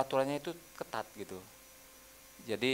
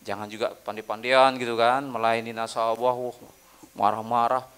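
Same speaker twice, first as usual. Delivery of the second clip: usual speaking voice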